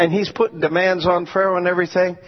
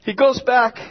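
ordinary talk

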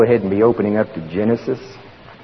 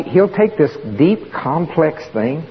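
slow and drawn out; 5 semitones higher, slower than usual. Acted. Slowing on a hard idea.

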